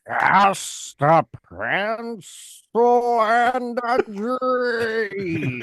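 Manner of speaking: put-on a drooping, drunken drawl; ink demon voice. Shown as a demonstration. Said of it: "That's a drunk, drunk Joey Drew".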